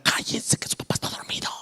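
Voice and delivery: urgent whisper; imitating mom shushing the kids in a whisper; mother's hushing whisper. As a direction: whisper so the sleeper stays asleep. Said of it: "mi mamá era".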